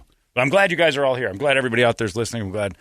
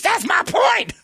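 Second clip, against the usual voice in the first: very high, loud crying yell; mock furious wife; 5 dB louder than usual. Imitating the wife screaming at her husband, high and crying. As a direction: shriek it like a wife in tears. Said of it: "She goes".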